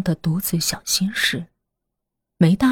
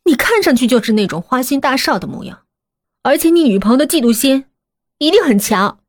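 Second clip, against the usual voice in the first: higher, mocking jab; sarcastic; 6 semitones higher, 4 dB louder than usual. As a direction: needle him with sarcasm. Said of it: "青青忙不迭地讽刺道".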